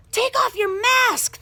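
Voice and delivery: put-on high, loud pestering kid; visiting children tugging her nose. A narrator's reading. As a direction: tease like a bold child. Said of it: "they said".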